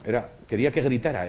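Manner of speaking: ordinary delivery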